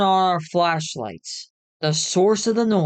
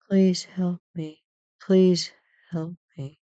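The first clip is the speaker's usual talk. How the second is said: put-on pleading little girl. A narrator's reading. Ghostly girl begs strangers for help, faint plea. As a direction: plead weakly, in halting pieces.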